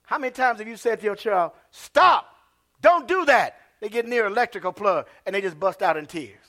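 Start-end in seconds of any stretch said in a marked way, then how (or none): 1.7-3.6 parent's warning. Retold or imitated. Parent scolding a child.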